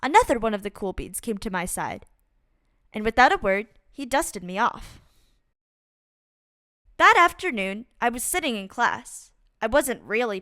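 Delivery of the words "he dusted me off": ordinary delivery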